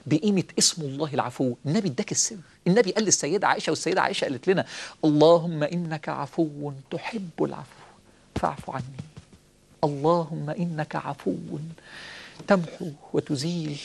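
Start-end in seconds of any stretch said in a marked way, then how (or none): 5.0-13.8 slow, drawn-out supplication; slow prayer. Acted. Preacher leading the congregation in prayer, slow and pleading. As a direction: plead each word slowly to God.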